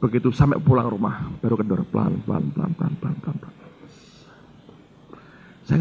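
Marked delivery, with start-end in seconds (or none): 1.9-3.6 soft, fading to a breath; fading away. Real, not acted. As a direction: let each pelan shrink until only breath is left. Tension slowly easing. (makin lama makin pelan sampai berbisik)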